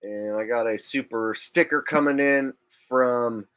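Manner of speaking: ordinary delivery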